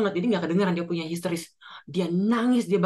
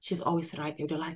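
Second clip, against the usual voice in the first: resigned dismissal; 5 dB quieter, faster than usual. Retold or imitated. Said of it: "karena saya pikir".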